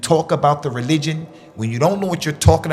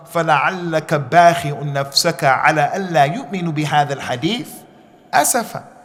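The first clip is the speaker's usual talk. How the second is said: quran recitation; much faster than usual. Acted. Quoting a Quran verse.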